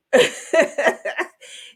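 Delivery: bright breathy laugh, high and loud; airy, joyful laugh; delighted laugh. Real, not acted. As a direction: burst out laughing at God's goodness. Delighted laugh mid prayer quote.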